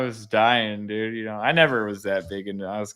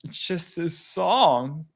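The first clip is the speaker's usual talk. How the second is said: lightly voiced sad kid, slow and higher; hurt young son; 6 semitones higher, much slower than usual. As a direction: imitate a heartsick little boy, drawn out. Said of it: "even a six-year-old".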